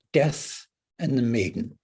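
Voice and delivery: usual speaking voice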